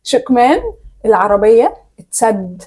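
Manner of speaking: slow, carefully mouthed; slowly. Shown as a demonstration. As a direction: draw each word out for lip reading. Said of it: "بالراحة".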